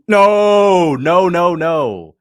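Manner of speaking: loud, high, drawn-out no tumbling into repeats; emphatic objection. Real, not acted. Streamer rejecting a claim about Strickland's jab, loud protest. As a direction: shout down the claim, then ease off.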